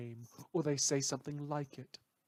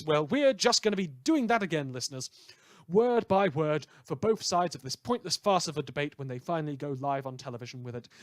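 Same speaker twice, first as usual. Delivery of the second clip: brash radio host; 2 semitones higher, 6 dB louder than usual. Acted. Radio host to his listeners, loud brash showman patter. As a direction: rattle off the tabloid news like a hyped DJ.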